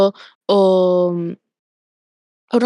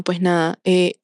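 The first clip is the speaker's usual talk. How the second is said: usual speaking voice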